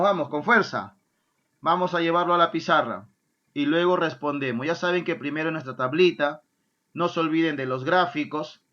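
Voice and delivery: ordinary talk, nothing marked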